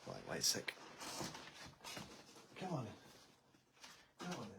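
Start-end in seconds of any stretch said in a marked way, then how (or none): 2.6-4.5 high, slow coaxing; coaxing pet voice. Real, not acted. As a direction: gently coax a shy pet out.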